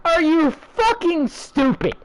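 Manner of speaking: exasperated disbelief; incredulous. Real, not acted. Incredulous rant.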